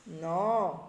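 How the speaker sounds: normal talking voice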